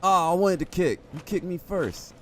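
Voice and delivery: high, drawn-out cry of complaint; loud protest. Real, not acted. Player kicked by an enemy, high-pitched protest.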